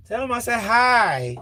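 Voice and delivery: high, hammed-up mock of her voice; imitating her again. Repeating her greeting in a high mock voice. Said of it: "How did you just say it?".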